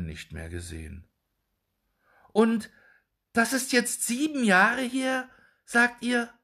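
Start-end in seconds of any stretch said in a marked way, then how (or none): 2.3-6.3 high, loud shocked question; shocked disbelief. A narrator's reading. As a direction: cry it out in disbelief. Dwarf shocked at the news. (fassungslos und entsetzt)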